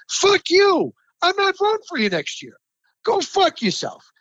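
real fury bursting out of the rant; furious outburst. Real, not acted. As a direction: explode at him, then spit the last words. Host rants at Hall candidate.

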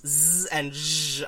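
"z" as a hissy buzz, "zh" as in vision; isolated phonemes. Shown as a demonstration. Insisting they are different phonemes, isolated sounds. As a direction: sound out "z" and "zh" as bare held consonants. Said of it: "are different phonemes".

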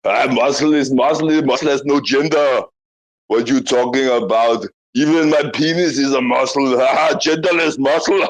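boastful mock-Austrian strongman, laughing; thick comic Austrian accent with braggart's laugh; Arnold. Bragging back at the comedian about muscle, Arnold impersonation. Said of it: "Arnold impersonation".

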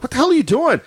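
high-pitched incredulous wife impression; scolding wife. Wife catching husband leaning into cooler, mock scolding. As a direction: imitate a wife catching her husband misbehaving.

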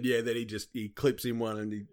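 ordinary delivery

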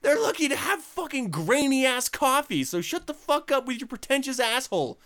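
high, loud exasperated rant; mock rant. Real, not acted. Host ranting at coffee snobs, high and loud. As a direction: tear into pretentious coffee snobs.

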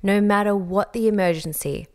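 ordinary talk

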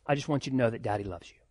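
a father's soft, quick "Daddy loves you"; tender whisper to daughter. Retold or imitated. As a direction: murmur it close, like a kiss on the head. Father tells daughter he loves her.

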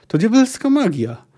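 high-pitched acted cry of alarm; acted yell. A narrator's reading. Sailor cries out at the whirlwind, acted yell. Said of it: "wywrzeszczał ktoś za plecami Asy".

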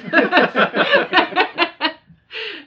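big burst of laughter; loud laugh. Real, not acted. Laughing at the retold line.

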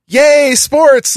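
mock-cheer like a clueless fan; imitating a cheer. Girlfriend cheering for the wrong team, mock squeal. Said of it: "she started to scream".

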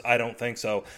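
usual speaking voice

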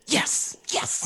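hushed, breathless little yes; excited whisper. Real, not acted. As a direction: whisper it in quiet triumph. Hears it's all Fridays.

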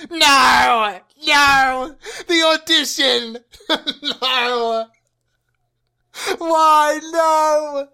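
shouting no, half laughing; real despair. Real, not acted. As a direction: cry out in comic despair. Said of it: "I lost it".

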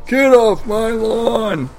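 put-on high, drawn-out cranky old man; geriatric voice. Co-host playing Deckard Cain fan's geriatric, cranky old man voice. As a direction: do a comic old-timer yelling, slowly. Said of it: "the angel of geriatric voices".

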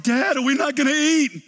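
put-on high, loud whiny child voice; whiny kid. Hungry kids whining to dad at dinnertime. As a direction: whine like a hungry kid at Disney. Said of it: "my kids are like".

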